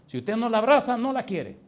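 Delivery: loud, high punch on abraza; preaching emphasis. Real, not acted. Preacher warning the parent, loud high emphasis. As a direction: hammer the point on abraza. Preacher drives point home.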